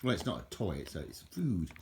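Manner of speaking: ordinary delivery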